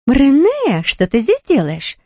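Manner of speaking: high, delighted squeal of the name. A narrator's reading. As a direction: squeal with surprise. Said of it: "взвизгнула Лив".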